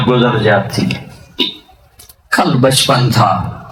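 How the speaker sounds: ordinary talk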